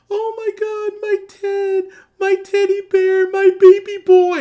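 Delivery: over-the-top sobbing mother, wailing; mock wailing mother. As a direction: ham up a grieving mother's wail over her son. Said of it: "the flashback to the funeral where she goes".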